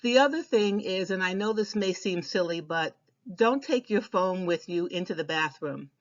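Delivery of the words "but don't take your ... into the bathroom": ordinary delivery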